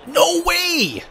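high-pitched cry of disbelief; shocked disbelief. Real, not acted. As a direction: cry out as if robbed. Disbelief at the play.

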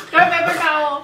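fast, laughing and loud. Real, not acted. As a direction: blurt it out between laughs. Laughing through burning mouth.

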